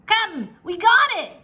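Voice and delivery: high, loud pirate yell; pirate shouts as the rock moves. A narrator's reading. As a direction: call out in triumph as a pirate. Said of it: "shouted Pinky".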